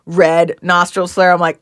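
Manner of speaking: ordinary talk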